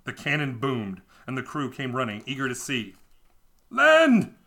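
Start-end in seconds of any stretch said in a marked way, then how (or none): 3.7-4.4 very high, loud cry of land; shouting. A narrator's reading. The crew seeing the island, shouting loud and high. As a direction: yell it like a lookout. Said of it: "they shouted".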